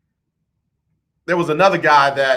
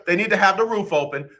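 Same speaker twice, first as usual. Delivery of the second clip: ordinary talk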